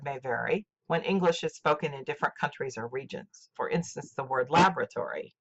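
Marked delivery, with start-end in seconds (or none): none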